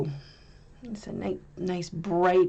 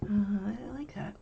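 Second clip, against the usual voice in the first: whispered aside; 4 semitones higher, 5 dB quieter than usual. Real, not acted. Whispered aside while painting.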